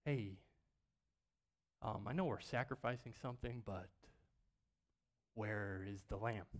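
hesitant, quiet, halting ask; timid question. Retold or imitated. Isaac quietly asking his father where the lamb is. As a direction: ask it quietly, afraid of the answer. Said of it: "this was".

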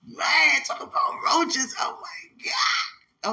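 loud, high cry of disgust; loud exclaiming. Real, not acted. Telling a friend about childhood roaches, loud and high. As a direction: wail it out, slow.

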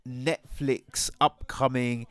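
ordinary talk, nothing marked

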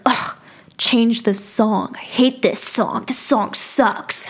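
slow, disgusted groan of complaint; imitating his annoyed whine, word by word; mad. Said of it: "like, mad".

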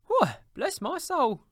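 slow, high, loud exclamation; thunderstruck, voice leaping up; astonished cry. A narrator's reading. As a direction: cry out in amazed delight. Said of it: "cried Fred".

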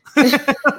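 bursting into giggles; high giggle. Real, not acted. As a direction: laugh it off high. Laughing at own impression.

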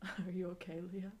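normal talking voice

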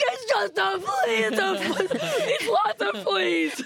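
high, slow mock disbelief with a laugh; laughing incredulous echo. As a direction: repeat his words back in disbelief. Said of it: "I'm like".

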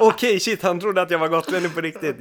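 retelling while still laughing, high and loud. Retold or imitated. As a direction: giggle through the disbelief. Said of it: "då började jag garva".

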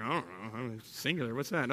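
low, very soft mock mumbling; unsure mumbling crowd. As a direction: play a puzzled audience under your breath. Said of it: "everybody like".

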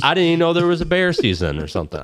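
high, fast imitation of an excuse; mocked clueless newcomer. Newcomer pleads ignorance to hunters, mocked high fluster.

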